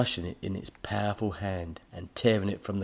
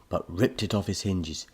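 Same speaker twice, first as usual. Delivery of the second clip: ordinary delivery, nothing marked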